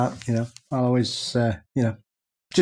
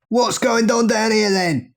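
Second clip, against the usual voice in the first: put-on slow, high, shouted London accent; bellowing Cockney geezer; 8 semitones higher, slower than usual. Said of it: "do my London accent".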